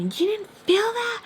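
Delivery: high-pitched yelled question, rushed; mock yell jumping far up in pitch; retold yell. Woman yelling at her in disbelief, retold high-pitched yell. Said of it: "She yells at me".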